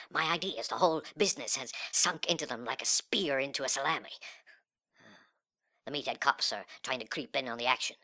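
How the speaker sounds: usual speaking voice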